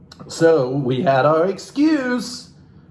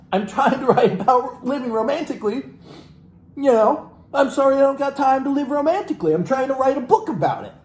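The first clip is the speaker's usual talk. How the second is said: higher, faster mock of the author; 11 semitones higher than usual. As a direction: parody her excuse, laughing. Mocking the author's excuse.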